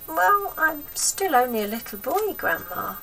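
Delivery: put-on timid boy pleading with his gran. George voiced in story.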